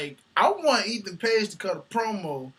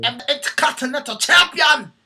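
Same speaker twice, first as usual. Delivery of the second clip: loud, higher boastful yell; mock champion shout; 8 semitones higher, 9 dB louder than usual. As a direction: bellow it like a wrestler on the mic.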